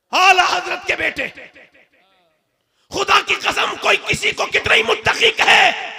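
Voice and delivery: full-force preaching, high and very loud; pregação inflamada. Acted. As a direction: thunder it out to the congregation, stretching key phrases.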